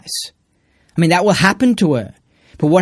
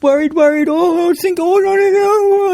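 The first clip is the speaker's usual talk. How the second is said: much higher fretting whine; mock worry; 4 dB louder, slower than usual. Mocking worried people, much higher fretful whine. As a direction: fret like a nervous wreck. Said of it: "You know, like".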